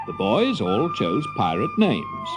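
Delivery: plain reading voice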